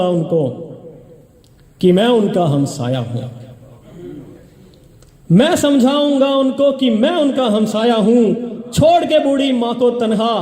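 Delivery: measured, rhythmic reciting of verse; stage poetry reading; poem recitation. Acted. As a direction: declaim each line to the audience, letting it fall. Recites poem, repeating lines.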